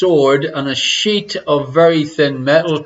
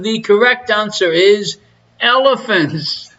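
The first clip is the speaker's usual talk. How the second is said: ordinary talk, nothing marked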